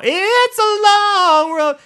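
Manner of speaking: long, high, drawn-out wail of a long road; stretched high. Acted. Complaining to listeners about the long walk, drawn-out high wail. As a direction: stretch it way up and slow.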